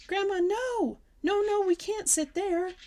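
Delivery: high, loud frightened protest; panicked cry. Acted. Girl panics as grandma sits on the bed, crying out. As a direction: stop her in alarm.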